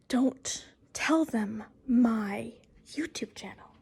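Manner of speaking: slow, menacing whisper; hushed mock threat. Daughter warning her mother about the channel, hushed. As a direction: whisper a playful warning, word by word.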